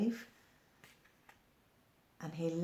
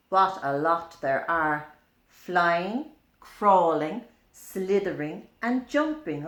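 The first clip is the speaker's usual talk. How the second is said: sing-song read-aloud verse; rhyme reading; 2 semitones higher, 7 dB louder than usual. A narrator's reading. Reading a picture-book rhyme to a child.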